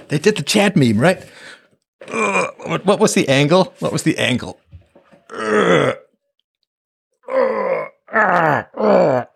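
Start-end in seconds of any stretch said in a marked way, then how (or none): 5.3-9.3 high, whimpering ughs; whining groans. Pretend. Host mock-whines to viewers over his shirt, high.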